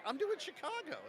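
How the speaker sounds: retold protest. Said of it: "I'm like".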